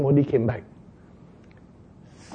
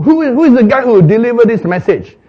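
louder, higher, fast royal demand; indignant king; 7 semitones higher, 6 dB louder, faster than usual. Acted. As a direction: demand answers like an angry king.

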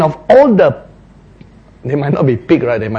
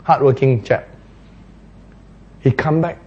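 usual speaking voice

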